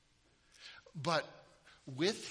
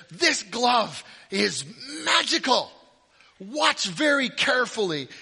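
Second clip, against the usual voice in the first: higher, louder stage-magician announcement; hammed-up magician; 6 semitones higher, 9 dB louder than usual. Children's church magician hamming it up for the bored kids. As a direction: play the church magician hyping his glove to the kids. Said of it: "he says".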